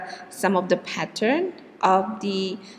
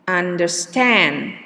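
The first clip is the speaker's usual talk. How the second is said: "understand" slow with under-STAND stressed at the end; drawn out, landing on STAND in "understand"; slower than usual. Shown as a demonstration. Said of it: "is on the last syllable".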